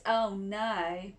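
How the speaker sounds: plain reading voice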